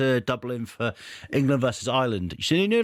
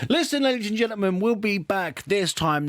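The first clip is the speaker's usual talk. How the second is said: announcer sign-off; 10 semitones higher than usual. Acted. Host wrapping up the podcast for listeners, in a bright announcer call. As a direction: ring it out like a compère.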